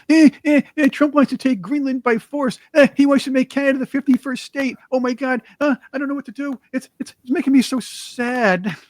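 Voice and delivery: high-pitched, hammed-up whining; mock panicked lefties wailing in distress; mock crying. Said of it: "They're crying every day".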